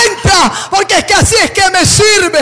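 loud, high urging call; God calling. Acted. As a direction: urge him in as God, loudly. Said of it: "Dios le decía".

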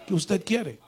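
acted curt demand; mildly stern, unwelcoming question. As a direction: ask brusquely what they want. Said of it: "tenía que hablarle así".

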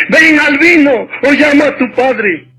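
mother warning. Acted. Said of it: "dijo la madre".

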